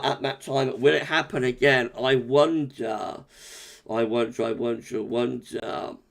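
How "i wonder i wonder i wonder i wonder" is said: fading wonder. Real, not acted. Musing about snow, repeated.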